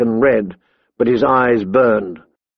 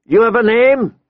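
high-pitched demanding question; calling out; 9 semitones higher than usual. A narrator's reading. Big man questioning the young lord, calling out. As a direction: call the question across. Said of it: "he called out to Robb".

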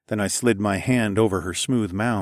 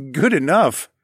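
laughing, louder, amused disbelief; 6 semitones higher, 3 dB louder than usual. A narrator's reading. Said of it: "I laughed".

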